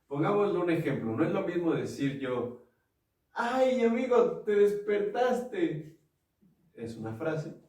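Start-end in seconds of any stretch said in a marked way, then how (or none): 3.3-6.0 high, slow, mocking drawl; sarcastic. Shown as a demonstration. Teasing a friend who woke up late, slow sarcastic drawl. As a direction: tease a late riser with sarcasm. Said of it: "la misma frase".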